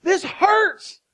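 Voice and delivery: loud, high-pitched cry of acted hurt; hammed-up pained outcry, pleading for help. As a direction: wail it like someone badly injured. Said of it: "You cried out".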